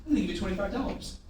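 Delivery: quick, soft, casual offer; playful offer. Shown as a demonstration. Salesman offering a guest money, playful and quiet. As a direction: slip it in quickly and softly. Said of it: "Smile, play a little bit, say".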